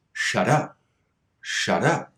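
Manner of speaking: "shut up" run together as "shuddup"; flap T linking. Shown as a demonstration. Teacher's favorite example, "shut up" linked by flap T. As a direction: link "shut up" with the T tapped like a D. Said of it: "find the flap T at the end of the word".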